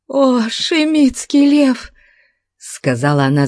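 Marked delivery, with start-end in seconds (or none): 0.1-1.9 high, slow, imploring woman's voice; gasping female captive. A narrator's reading. Kneeling captive gasps to her captor, high breathless plea. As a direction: plead high and drawn-out, as if short of breath. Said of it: "задыхаясь и вставая на колени".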